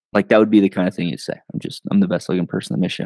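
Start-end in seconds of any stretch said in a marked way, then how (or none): 1.5-3.1 dry deadpan. Retold or imitated. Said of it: "it was dry".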